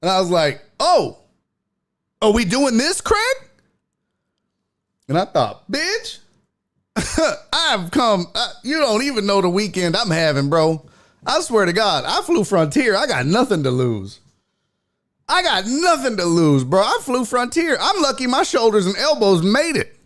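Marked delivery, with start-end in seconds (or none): 0.8-3.5 high-pitched, rising question; mock-incredulous challenge. Comic to heckler who just stood up, incredulous challenge. 5.7-20.0 high-pitched, worked-up tirade; riled-up rant. Retold or imitated. Comic fed up after bad weekend, high riled-up rant.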